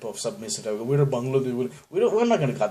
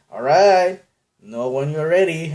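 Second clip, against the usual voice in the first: louder, higher, annoyed waiter; eye-rolling waiter; 5 semitones higher, 8 dB louder than usual. Retold or imitated. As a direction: sigh it out with an eye roll. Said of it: "they roll their eyes".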